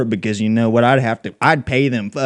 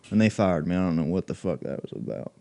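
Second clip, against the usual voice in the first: ordinary talk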